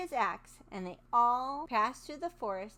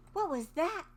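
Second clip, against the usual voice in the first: timid; 7 semitones higher, 4 dB louder than usual. Acted. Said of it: "she asked timidly".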